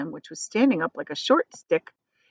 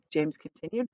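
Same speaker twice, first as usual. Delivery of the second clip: ordinary delivery, nothing marked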